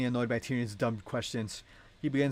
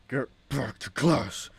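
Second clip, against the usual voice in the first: slow, louder scolding principal voice; Belinsky Sir Hai voice; 6 dB louder, much slower than usual. Acted. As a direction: bark it slowly at the student like a stern principal. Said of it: "let me do that in the Belinsky Sir Hai voice".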